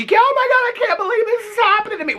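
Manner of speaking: very high-pitched mock disbelief; mock shocked girlfriend. Scammed girlfriend reacting to her divorce, exaggerated high-pitched dismay. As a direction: imitate a dismayed woman in a squeaky high voice.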